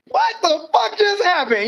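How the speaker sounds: high, strained what the fuck; stunned disbelief. Retold or imitated. Recalling the vision closing, he blurts out high, stunned disbelief. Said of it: "And I".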